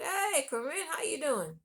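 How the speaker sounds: bright hammed-up hello; cheerful friend. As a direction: play the upbeat buddy picking up. Friend answering phone cheerfully.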